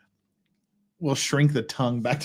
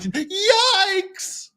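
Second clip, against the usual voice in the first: mock yikes. Mocking exclamation in brief.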